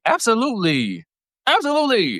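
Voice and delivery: rising to a high emphatic peak; emphatic agreement. Real, not acted. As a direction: agree harder each time.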